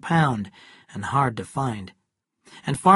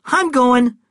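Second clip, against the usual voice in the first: loud retort; 11 semitones higher, 10 dB louder than usual. Acted. Cal answers the shout.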